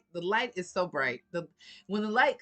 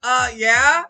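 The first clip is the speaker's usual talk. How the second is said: mock loud yeah; 3 semitones higher, 14 dB louder than usual. Her mocking reply, loud.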